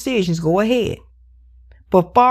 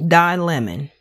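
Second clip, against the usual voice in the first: ordinary talk, nothing marked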